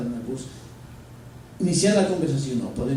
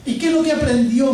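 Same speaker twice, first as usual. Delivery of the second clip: higher, louder eager asking; eager question; 11 semitones higher, 10 dB louder than usual. Retold or imitated. Student asking his director about the seminar, eager and louder. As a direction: ask with bright curiosity. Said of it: "me preguntó".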